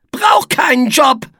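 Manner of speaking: high, whiny character moan; loud grumpy whine; groaning refusal. A narrator's reading. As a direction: groan it out, thoroughly put upon. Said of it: "stöhnte Etze".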